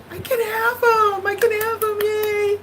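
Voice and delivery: high, thrilled, almost teary; giddy relief. Retold or imitated. Discount brings price down, high giddy relief. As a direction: squeal it like a kid getting a gift.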